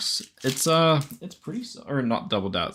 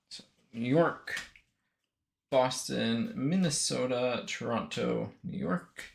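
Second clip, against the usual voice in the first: muttered to self; 8 dB quieter than usual. Real, not acted. Muttering teams while sorting cards.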